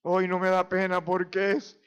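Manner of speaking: usual speaking voice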